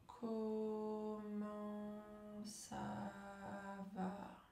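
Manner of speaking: COMMENT ÇA VA very slow and soft, every syllable held; stretched syllables. Shown as a demonstration. Coach leads breathing exercise, drawn-out COMMENT ÇA VA. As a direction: draw out each syllable of COMMENT ÇA VA on a level, soft tone. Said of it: "allonger le mot, allonger la syllabe".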